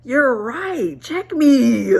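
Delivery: high, loud, playful admission. Real, not acted. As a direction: cry out that they're right, half laughing. Laughing, telling herself she was wrong.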